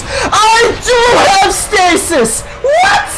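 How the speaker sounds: high, drawn-out screamed realisation; screamed disbelief. Real, not acted. Gamer realises the forgotten power, screaming in disbelief. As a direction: scream it slowly, climbing to a squeal. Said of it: "I think I was screaming".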